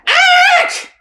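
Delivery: very high, loud shrieking squawk. Acted. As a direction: squawk in disgust with hands over ears.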